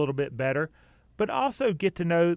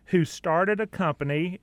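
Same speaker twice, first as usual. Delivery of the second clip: usual speaking voice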